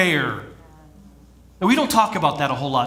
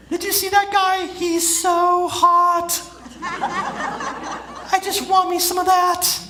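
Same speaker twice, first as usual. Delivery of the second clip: man doing a woman's voice; 13 semitones higher than usual. Acted. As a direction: gush like a boy-crazy girl. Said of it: "there's my best girl voice".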